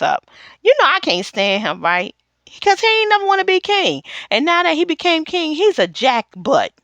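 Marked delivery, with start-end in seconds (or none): none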